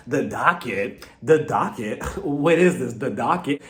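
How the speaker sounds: slow, low mocking repeat; eye-rolling scorn. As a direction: imitate someone scoffing at a word. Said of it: "kept rolling her eyes".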